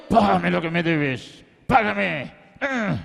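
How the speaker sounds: low, strained, slow threat; acted menace through effort; throttling creditor. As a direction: growl it while choking someone. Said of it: "Lo agarró por el cuello".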